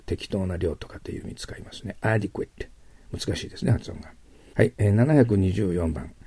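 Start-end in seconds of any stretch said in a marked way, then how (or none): none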